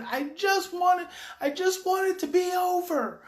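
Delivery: high, drawn-out plaintive wail; slow mock-tearful plea; imitated distressed child. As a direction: wail it like an overwhelmed boy. Retold upset boy's desperate plea.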